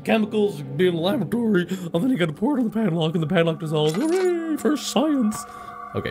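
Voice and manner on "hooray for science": slow, high mock hooray; sarcastic cheer. Real, not acted. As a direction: drag it out with sarcasm. Sarcastic cheer at cliché puzzle.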